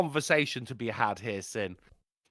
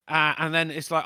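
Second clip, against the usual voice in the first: ordinary talk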